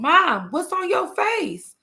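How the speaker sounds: put-on high, drawn-out kid's question; imitated puzzled child. Another child questions crying mother, mimicked high puzzlement. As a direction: mimic a kid calling out to mom, slow and high.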